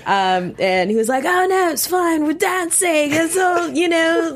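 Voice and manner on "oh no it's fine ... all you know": put-on high-pitched, botched British accent; hopelessly off mock British accent; horrible British accent. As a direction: badly imitate a British guy being breezy. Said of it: "That was not his accent".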